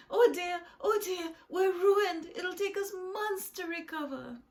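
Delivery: very high, loud lament; hammed-up, high-pitched despair; panicked wailing. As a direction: wail as if all is lost. Freddie wails that they are ruined.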